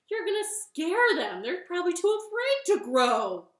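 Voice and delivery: loud, high-pitched reproach; exasperated scolding. Acted. Frog scolds Toad for yelling.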